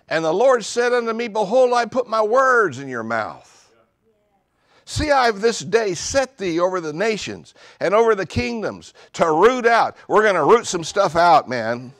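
loud, high preaching at full force; fiery preaching. Acted. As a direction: preach it to the rafters.

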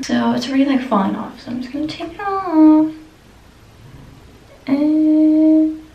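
face covered by wet mask; talking with sheet mask on. Real, not acted. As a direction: lips framed by mask opening. Seen 1: Wet sheet mask covers face, mouth hole open, through all labelled words.

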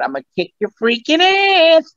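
high-pitched goofy threat; squeaky mock menace; movie catchphrase. Shown as a demonstration. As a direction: deliver the comic threat in a squeaky character voice. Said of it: "I came up with a part".

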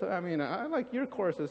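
normal talking voice

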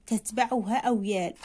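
ordinary talk

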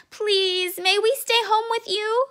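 high, begging little-cub voice; pleading child. Acted. Chester begs his mother to stay home, pleading high.

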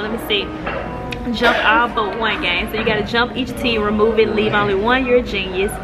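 normal talking voice

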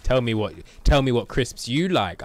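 loud, insistent defensive demand. Acted. Streamer mimicking himself grilling viewers about crisps, defensive demand. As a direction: press hard for an answer, defensively. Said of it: "not trying to be defensive and be like".

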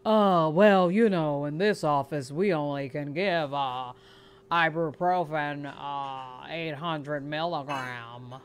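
low, hesitant mock doctor; deep-pitched, halting doctor voice; doctor impression. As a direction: play a doctor stalling with slow "uh"s. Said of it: "excuse me, Doc".

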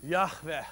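throaty Yahveh; Hebrew pronunciation. Shown as a demonstration. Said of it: "such clear throats".

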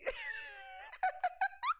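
a very high laugh rising out of tears; squealing teary laugh. Real, not acted. Laughing while crying on stream.

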